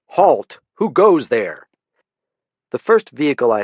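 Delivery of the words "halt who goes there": higher, louder mock sentry call; mock challenge shout. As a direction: bark it out like a playful guard. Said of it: "I was tempted to cry out".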